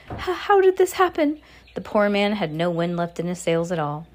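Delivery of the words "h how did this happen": very high, bewildered stutter; dumbfounded father; stammered. A narrator's reading. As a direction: catch on the first sound, voice pitched right up. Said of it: "stammered Mister Jenkins".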